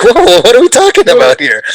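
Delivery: high, loud, alarmed whoa; startled protest. Retold or imitated. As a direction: back away in shocked disbelief. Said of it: "I was just like".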